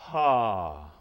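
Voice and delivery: loud, released, breathy ha; breathy open ha. Shown as a demonstration. Teacher showing students an open, released, breathy ha. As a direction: let the ha fall out open and airy.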